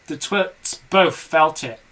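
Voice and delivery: ordinary delivery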